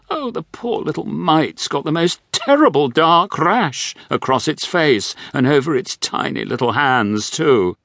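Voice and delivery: high, fussing grand dowager; a hint of a gossiping grand dame; wealthy widow. A narrator's reading.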